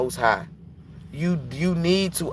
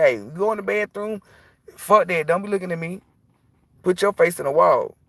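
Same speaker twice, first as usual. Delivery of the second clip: higher, bossy; mock stern orders; 6 semitones higher than usual. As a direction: lay down bathroom rules. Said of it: "I said".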